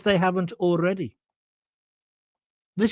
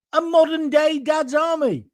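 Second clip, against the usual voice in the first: lightly voiced higher-pitched impression; a hint of a sitcom character, pitched up; Clive Dunn; 6 semitones higher than usual. Host proposing troops protect borders, light comic impression. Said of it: "That's my impression of Clive Dunn".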